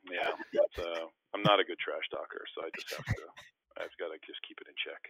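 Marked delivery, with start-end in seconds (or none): none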